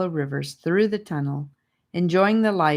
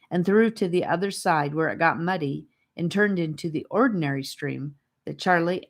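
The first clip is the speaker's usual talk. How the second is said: ordinary delivery, nothing marked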